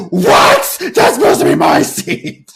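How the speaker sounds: loud, indignant protest; hammed-up fury at losing the seat; mock outrage. Gabrielle, furious, complaining to the sisters about her seat, in loud mock outrage. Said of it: "like, pissed off".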